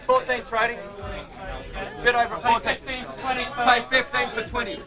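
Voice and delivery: high, urgent pit calls; trading floor shouting. Real, not acted. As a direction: call out bids across the pit. Traders calling bids in the pit.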